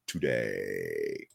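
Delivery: low, soft, stretched-out word; drawn-out sign-off. Real, not acted. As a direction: let it sink low and trail long.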